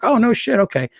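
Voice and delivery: a sudden high "no shit", then settling down; retold surprise. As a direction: jump up in pitch on "no shit" in mock astonishment. Retold reaction to competitor news.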